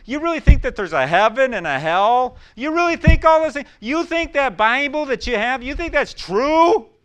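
loud, high, sneering disbelief, slowing at the end; mocking skeptic. Preacher mimicking a scoffer, loud mocking disbelief. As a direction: scoff at the believer like a doubter.

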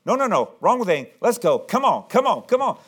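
insistent protest climbing in pitch; impatient urging. Acted. Jairus hurrying Jesus when he stops, impatient.

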